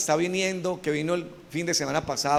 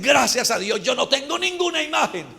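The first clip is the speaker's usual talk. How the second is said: mock pious boast, climbing high; self-righteous believer; 7 semitones higher than usual. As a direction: parody a smug churchgoer, pitch rising. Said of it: "Porque hay hermanos que dicen".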